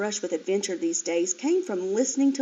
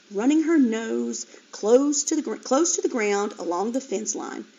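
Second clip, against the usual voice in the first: ordinary delivery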